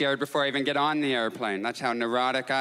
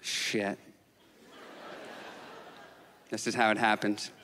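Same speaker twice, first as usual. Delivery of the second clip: dread; 5 semitones lower, 5 dB quieter than usual. Retold or imitated. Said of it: "I was like".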